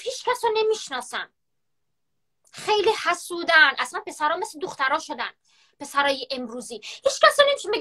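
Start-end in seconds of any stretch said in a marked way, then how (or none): 0.0-1.3 higher mocking echo of his words; mocking quote. Mocking her ex-boyfriend's lie again, in a high sneering voice. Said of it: "چقدر دروغ میگه". 6.8-7.8 much higher, louder mocking squeal of his words; mocking quote. Ridiculing her ex's jealous lie for viewers, in a high loud mocking voice. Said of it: "چقدر دروغ میگه".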